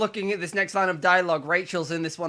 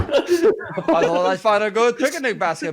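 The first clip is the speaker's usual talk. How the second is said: louder cartoon bear voice; Yogi Bear voice; 3 semitones higher, 5 dB louder than usual. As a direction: play a jolly cartoon bear.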